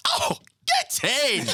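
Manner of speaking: very high, slow British "Oh, get in!"; British accent. Retold or imitated. British husband handed extra food by his wife, British accent. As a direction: squeal it like a delighted Brit. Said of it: "It was a British guy".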